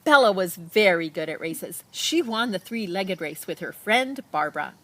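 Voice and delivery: usual speaking voice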